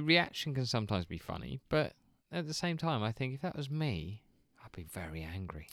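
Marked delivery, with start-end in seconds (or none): 4.6-5.7 unvoiced hushed whisper. Real, not acted. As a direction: breathe it out quietly. Whispered aside.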